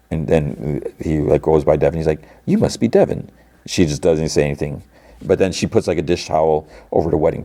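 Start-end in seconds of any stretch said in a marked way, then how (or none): 2.4-3.3 high, prim mock Milchick; imitating Milchick, pitched high; Milchick impression. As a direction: pitch up into a polite company man.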